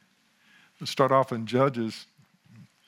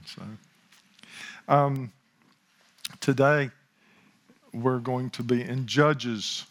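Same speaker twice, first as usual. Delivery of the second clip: usual speaking voice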